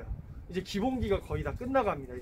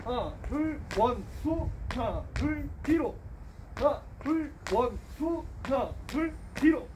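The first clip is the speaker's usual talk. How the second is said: coach count calls; 6 semitones higher, slower than usual. Shown as a demonstration. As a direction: bark the count to the class. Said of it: "시작".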